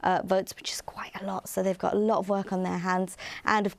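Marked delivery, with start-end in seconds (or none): 0.6-1.5 whispering. Real, not acted. Whispered aside about judges' workload.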